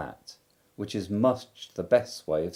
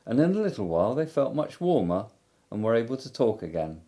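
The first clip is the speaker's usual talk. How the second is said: ordinary delivery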